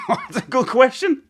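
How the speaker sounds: amused laugh breaking into the line. Real, not acted. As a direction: still giggling as you answer. Laughing at an absurd choice.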